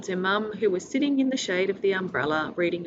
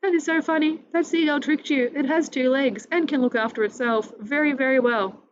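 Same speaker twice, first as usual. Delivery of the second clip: amused; 6 semitones higher, 3 dB louder than usual. Acted. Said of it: "She was laughing so much".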